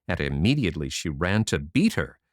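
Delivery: ordinary delivery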